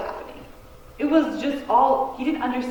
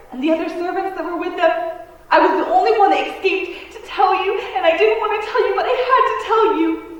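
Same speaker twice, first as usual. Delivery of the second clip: frantic messenger; 7 semitones higher, 7 dB louder than usual. Acted. Servant blurts children's deaths.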